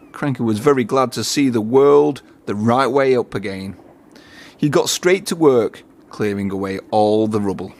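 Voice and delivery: usual speaking voice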